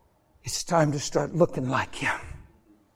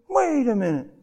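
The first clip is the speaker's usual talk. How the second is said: suspicious onlooker; 6 semitones higher than usual. Acted. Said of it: "then one guy goes".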